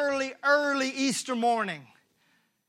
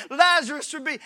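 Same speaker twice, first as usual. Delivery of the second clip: mild lament; 4 semitones higher, 5 dB louder than usual. Acted. Mary's grief quoted.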